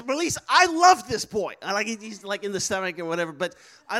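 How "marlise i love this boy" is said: excited gush. Retold or imitated. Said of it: "I tell Marlise".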